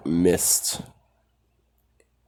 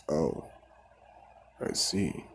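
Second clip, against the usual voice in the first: breathy near-whisper, much softer; quiet, knowing aside; hushed; 2 semitones lower, 10 dB quieter, slower than usual. Retold or imitated. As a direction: let it fall to a whispered "I see". Mist guy reacts to quirkless boy.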